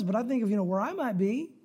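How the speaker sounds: ordinary delivery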